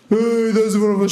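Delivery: high mocking whine of a complainer; high, mocking whine of a Swedish critic crying on Instagram; mock whiny crybaby. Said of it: "that Swedish guy also crying on the Instagram".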